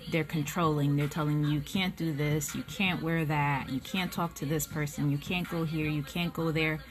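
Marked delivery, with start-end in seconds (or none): none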